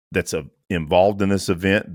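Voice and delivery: usual speaking voice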